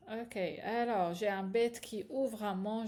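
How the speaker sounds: usual speaking voice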